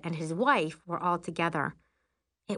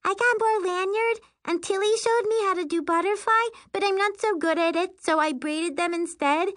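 put-on little girl voice; 12 semitones higher, 5 dB louder than usual. Little sister Prissy chatters excitedly.

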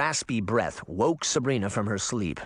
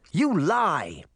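accusing; 6 semitones higher than usual. A narrator's reading. Sabrina accuses the girl.

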